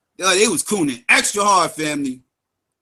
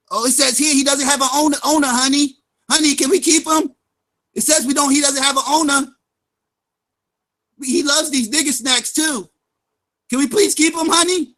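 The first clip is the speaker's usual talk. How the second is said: higher, sweet pet-shop couple voice, lightly put on; mock pet adopter; 6 semitones higher than usual. Mocking guests as a stray dog, higher cooing couple voice. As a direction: play a couple begging to keep a stray.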